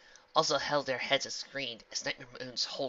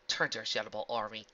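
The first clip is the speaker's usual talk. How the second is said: ordinary delivery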